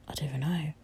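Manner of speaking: very soft whisper to self; barely voiced, puzzled mumble; whispered aside. Real, not acted. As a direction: whisper it, thinking aloud. Thinking aloud, forgot episode number.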